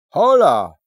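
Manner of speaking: loud high hail; a bright loud call from afar. Acted. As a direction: shout it across the road. Little man calls out greeting.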